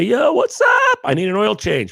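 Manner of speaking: yelled "yo" in a hammed-up casual dude voice; dude-bro voice. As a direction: play a laid-back bro calling the dealer. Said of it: "He doesn't talk like that".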